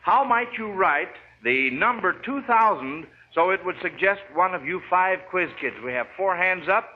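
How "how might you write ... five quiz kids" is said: ordinary talk, nothing marked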